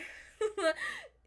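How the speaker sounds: a high burst of laughter; bright laugh. Real, not acted. Laughs at herself.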